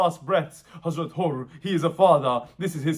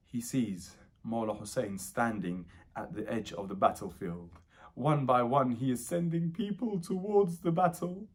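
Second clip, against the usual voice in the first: very low, soft, solemn storytelling; hushed tragic narration; 6 semitones lower, 9 dB quieter than usual. Acted. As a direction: drop right down for the sorrowful scene.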